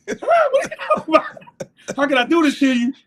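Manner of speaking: high, loud, fast whiny protest; a panicked player begging; teammate's plea. Retold or imitated. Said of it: "Labenjo comes up to me and said".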